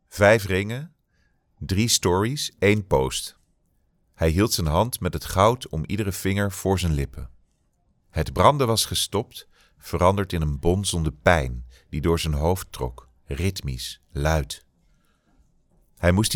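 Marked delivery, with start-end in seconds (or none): none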